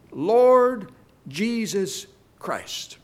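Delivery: "Lord", "Jesus", "Christ" slowly, with pauses between; each name separate. Shown as a demonstration. Preacher shows three names in the text, "Lord", "Jesus", "Christ" slow and separate. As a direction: set "Lord", "Jesus" and "Christ" apart, one by one. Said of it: "we read all three names".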